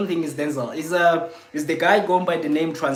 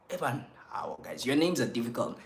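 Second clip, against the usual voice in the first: unvoiced whisper to himself; 2 semitones lower, 7 dB quieter than usual. Real, not acted. Streamer whispering to viewers about hard names, hushed. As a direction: whisper it under your breath.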